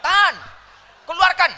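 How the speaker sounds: high, loud delivery-room commands; urgent coaching shout. Acted. Doctor telling mother to hold and push, urgent shout.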